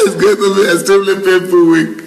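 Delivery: laughing. Real, not acted. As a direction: reread it cracking up. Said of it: "re-read with laughter".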